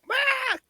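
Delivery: sudden high goat-like scream. Retold or imitated. Drill sergeant sneaking up behind recruits, mock goat scream. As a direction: bleat out a sharp goat scream to startle. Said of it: "like, that goat scream".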